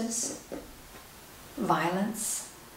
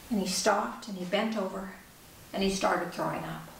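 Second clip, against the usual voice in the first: usual speaking voice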